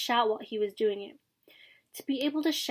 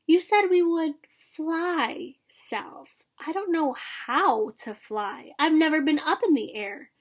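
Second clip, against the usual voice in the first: high, slow, drawn-out youngster's voice; young swan speaking up; 6 semitones higher, slower than usual. A narrator's reading. Cygnet tells father he cannot fly, plaintive questioning. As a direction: stretch the key words high like a worried child. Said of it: "One of his brothers spoke up".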